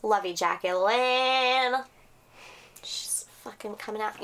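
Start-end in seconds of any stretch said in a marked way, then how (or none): 2.8-4.2 unvoiced whisper aside to the others; hushed remark behind the mic. Real, not acted. Telling the cohosts someone is coming out, whispered. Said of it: "whispered aside".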